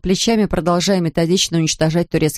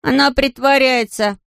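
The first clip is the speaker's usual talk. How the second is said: put-on objecting little boy; 7 semitones higher, slower than usual. A narrator's reading. Patrick objecting in story.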